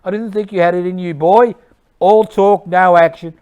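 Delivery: high, loud mocking shout. Il Duce jeering at the dead anti-fascist, loud shouting. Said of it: "Il Duce shouts".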